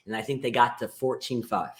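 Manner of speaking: ordinary talk, nothing marked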